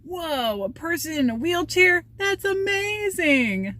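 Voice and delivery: put-on high, slow, amazed child's exclaim; childlike delighted high voice; excited little boy. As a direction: mimic a kid's wide-eyed wonder. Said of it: "he yelled".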